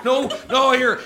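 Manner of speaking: ordinary delivery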